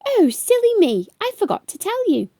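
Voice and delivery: dad suddenly remembering at bedtime, exclaiming; sudden exclamation. A narrator's reading. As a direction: blurt it as if just remembered. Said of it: "he suddenly exclaimed".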